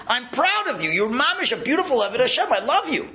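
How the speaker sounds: high-pitched, mildly acted proud praise; glowing praise. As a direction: voice a delighted, loving declaration up high. God's praise voiced for worshipper.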